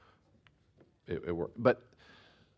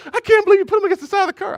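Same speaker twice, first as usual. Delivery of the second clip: high, loud, fast appalled wife; shocked wife; 14 dB louder, faster than usual. Retold or imitated. A husband imitating his wife's shock at the roadside. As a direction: gasp it out like a scandalized wife. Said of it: "and Elaine goes".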